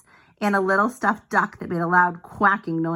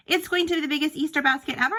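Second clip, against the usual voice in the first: eager boast; 7 semitones higher, much faster than usual. Acted. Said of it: "said Clayton".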